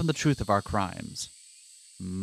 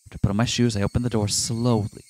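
measured, even narrator read; dramatic visual novel narration; story narration; 4 semitones lower than usual. Acted. Reads game narration aloud.

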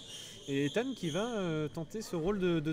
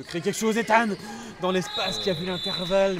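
excited sports commentary; 4 semitones higher, 8 dB louder than usual. Real, not acted. Attack play-by-play rises.

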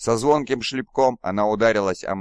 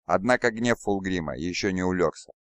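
usual speaking voice

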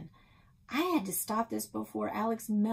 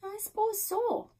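put-on older woman voice. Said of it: "Nan said".

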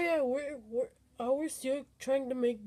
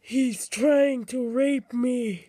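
loud, slow, drawn-out wail for help; alarmed yelling; 9 dB louder, slower than usual. Pretend. Player under attack in the game, alarmed yelling. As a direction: holler it in mock panic.

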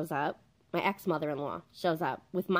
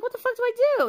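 shrill high panic, much louder; panicked; 8 dB louder, much faster than usual. Retold or imitated. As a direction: freak out at the top of your voice. Panicked text to producer.

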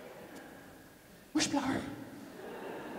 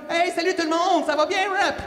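a bit higher, chirpy hello to the crowd; eager greeting; 9 semitones higher than usual. Retold or imitated. Speaker replays greeting the crowd at his first talk, eager. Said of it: "Et moi, j'arrive là".

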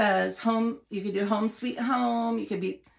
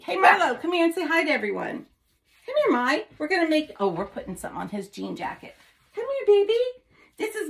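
high calling voice; 7 semitones higher than usual. Real, not acted. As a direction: call the dog sweetly, pitched up. Calling the dog over.

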